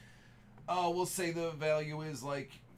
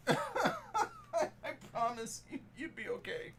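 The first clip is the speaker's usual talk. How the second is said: laughing; 9 semitones higher, 3 dB quieter, slower than usual. Real, not acted. Streamer laughing while apologizing.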